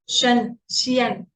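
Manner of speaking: "शन" and "शियन" drawn out slowly; teacher modelling endings; slow suffix demo. Shown as a demonstration. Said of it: "ऐसे बोलना है".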